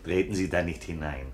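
character voice. Acted. Narrator voices character quote.

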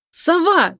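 calling out. A narrator's reading. Girl cutting off her friend on the phone, crying out. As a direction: cry the nickname to stop him. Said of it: "кричит".

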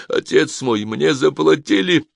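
high-pitched pleading; frightened babbling. A narrator's reading. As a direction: blurt it out, near tears. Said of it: "пролепетал он".

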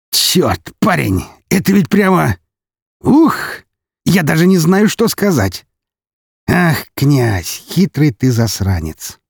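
high exclamations settling into admiring drawl; lost for words, marvelling at the sly prince; amused amazement. Acted. (с восхищённым изумлением)

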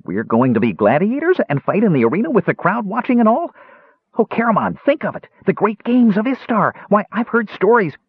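high, breathless-with-delight kender voice; thrilled, very high-pitched little enthusiast; excited kender. A narrator's reading. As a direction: gush eagerly in a high voice. Excited kender thrilled about gladiators.

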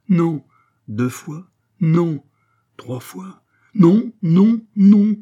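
high, very loud no's; shouted refusals. Acted. Abbé refusing Pitou three times, loud shouts. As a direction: bark each refusal harder.

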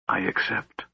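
quiet, heavy-hearted yielding; softly giving in to a dark bargain; grim acceptance. A narrator's reading. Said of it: "Raistlin repeated more calmly".

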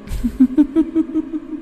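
very high, echoing staccato laugh; ghostly giggle. A narrator's reading. Ghost gloats over Lợi, high eerie giggle. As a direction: titter eerily in even bursts.

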